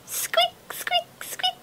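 high squeaks fading to a whisper; tiny mouse squeaks. A narrator's reading. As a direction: squeak smaller and smaller.

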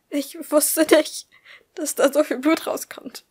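slow start, high and loud dismay; distraught. Acted. Woman telling her friend about the blood, distraught. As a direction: confess it in a high, panicked voice.

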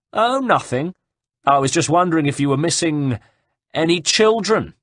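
high, mock-innocent call; grinning taunt. A narrator's reading. Grinning horseman taunts Caterina about her missing children, mock-innocent. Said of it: "The man grinned broadly".

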